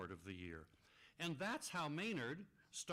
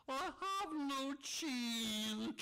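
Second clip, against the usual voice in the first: mock dismay; 12 semitones higher, 4 dB louder, much slower than usual. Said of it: "said a poor moose".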